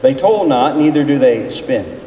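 usual speaking voice

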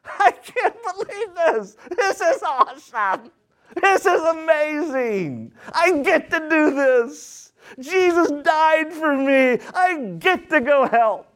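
high, slow, gushing delight, nearly shouting at the peak; overjoyed giver. Acted. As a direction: gush like a giver who can't believe his luck. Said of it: "that you're just like".

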